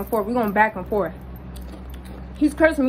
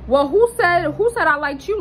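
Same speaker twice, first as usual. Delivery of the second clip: high-pitched challenging retort; sassy comeback; 6 semitones higher, 3 dB louder than usual. Retold or imitated. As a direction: throw the insult back with raised pitch. Retold comeback to an insult.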